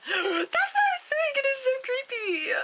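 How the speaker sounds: very high, slow, loud outburst; spooked outburst. Acted. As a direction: gasp and then exclaim. D-9 spooked that the girl moves away.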